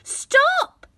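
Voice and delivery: put-on greedy boy crying at the pot to stop. A narrator's reading. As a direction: yell at the pot in panic. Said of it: "cried the boy".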